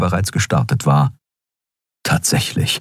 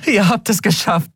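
much higher, joyful cheer; jubilant; 14 semitones higher than usual. A narrator's reading. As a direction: cheer with pure relief. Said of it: "jubelte Endriel".

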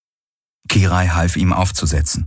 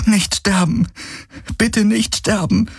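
high, desperate begging; pleading; 13 semitones higher than usual. A narrator's reading. Kirai begs bleeding Hawks to live, desperate pleading. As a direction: beg in a high voice, slowing on the repeat. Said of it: "flehte sie".

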